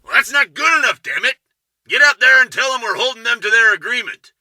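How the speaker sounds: roaring angry. A narrator's reading. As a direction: chew out an employee at full roar. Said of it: "The roar of Hank Caswell chewing".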